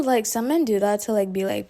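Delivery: usual speaking voice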